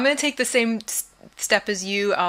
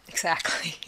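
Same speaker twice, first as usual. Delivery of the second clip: whispered; 3 semitones lower, 4 dB quieter than usual. Real, not acted.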